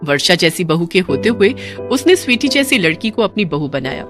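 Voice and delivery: ordinary delivery, nothing marked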